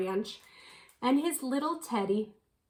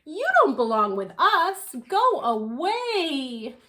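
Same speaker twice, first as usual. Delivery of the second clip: louder, cold brush-off, slowing on go away; haughty dismissal; 5 semitones higher, 5 dB louder than usual. Acted. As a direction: send the stranger packing. Snobby owls reject him.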